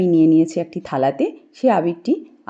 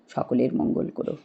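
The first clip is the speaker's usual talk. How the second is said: low, hushed plea to the Lord; whispered prayer; 5 semitones lower, 6 dB quieter than usual. Real, not acted. Host whispering a prayer for everyone's good. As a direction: murmur a blessing under the breath.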